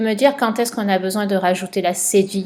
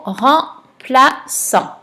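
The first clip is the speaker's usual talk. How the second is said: slow and a bit louder, syllables drawn out; slow dictation; 4 semitones higher, 4 dB louder, much slower than usual. Shown as a demonstration. Teacher giving the next word to a pupil, slowly. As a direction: let each syllable land slowly. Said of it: "Le mot suivant".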